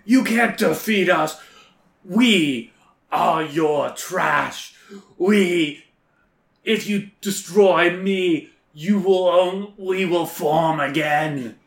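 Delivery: lightly voiced monster, slow threats; sputtering foiled villain. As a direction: menace as the trash monster. Trash monster speaks while dying.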